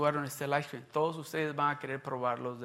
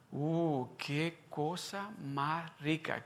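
slow savoring; 5 dB quieter, much slower than usual. Retold or imitated. Saborea el recuerdo del helado.

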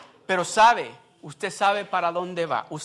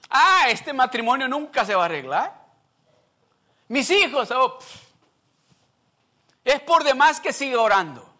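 high, loud, exasperated lament; despairing complaint; 8 semitones higher, 8 dB louder than usual. Retold or imitated. A discouraged believer complains to God, loud and despairing. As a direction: moan in despair that nothing ever changes. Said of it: "Le ha contestado usted así a Dios".

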